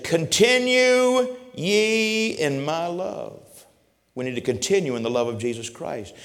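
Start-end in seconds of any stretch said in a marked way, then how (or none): 0.0-3.4 Jesus' words. Acted. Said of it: "Jesus said".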